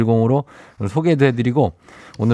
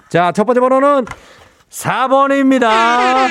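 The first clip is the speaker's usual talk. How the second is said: infomercial; 14 semitones higher, 4 dB louder, slower than usual. Acted. Revealing the drawn number.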